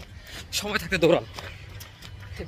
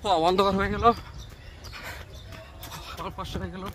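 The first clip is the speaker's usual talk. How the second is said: while running; 8 dB quieter than usual. Real, not acted. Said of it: "দৌড়াচ্ছি".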